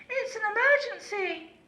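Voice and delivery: very high, loud alarm call; urgent shout. A narrator's reading. Lazy owl fakes an emergency to the horse, shouting. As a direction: sound the alarm in a panic. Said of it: "cried Aussie".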